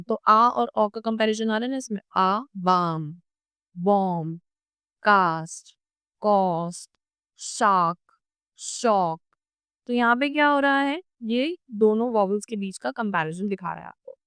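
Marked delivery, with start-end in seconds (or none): 2.2-9.2 slow contrast of aa and rounded o; vowel comparison pairs. Shown as a demonstration. Teacher contrasting two vowels in word pairs. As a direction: draw out बाम, then round for बॉम. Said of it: "comparison करने की बात करें".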